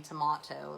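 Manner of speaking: British "tomato" with an AH, "tuh-MAH-toe"; posh British "tomato". Shown as a demonstration. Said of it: "I think I'm so posh".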